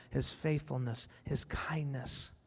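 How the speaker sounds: soft reverent whisper; hushed whisper. Acted. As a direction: fade the list into a whisper. Sermon list fading to hush.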